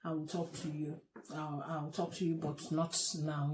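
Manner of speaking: calm him down gently; comforting. Retold or imitated. Wife comforting her crying husband at 2 AM, soft reassurance. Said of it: "I started comforting him and said".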